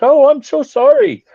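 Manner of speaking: high, loud squeaky apology; high loud comic voice for the giant; mock sumo apology. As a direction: play the giant as oddly tiny and polite. Voicing the huge sumo wrestler.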